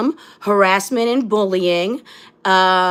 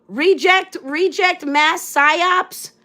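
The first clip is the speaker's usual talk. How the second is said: higher, slow sing-song "reject mass psyops"; mocking the troll; 6 semitones higher, much slower than usual. Mimicking the troll's comment to her viewers, higher and mocking. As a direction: parrot the comment back higher and slowly, ridiculing it. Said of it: "oh, victim mentality".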